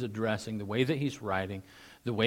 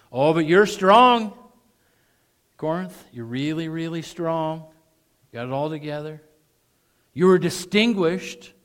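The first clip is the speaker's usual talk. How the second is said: high, loud mocking praise; mock admiration; sarcastic; 7 semitones higher, 11 dB louder than usual. Shown as a demonstration. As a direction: sneer the compliment. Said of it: "thick with sarcasm".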